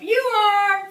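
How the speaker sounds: high, very loud holler; yelling up. Retold or imitated. Hunters cussing out the woman in the tree, loud yell. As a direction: bellow it up into the treetop. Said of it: "they yelled up".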